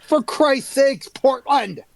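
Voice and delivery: high, slow exasperated cry; exasperated yell. Acted. Player's character comes out scolding Portland, exasperated yell. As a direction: draw it out in mock outrage.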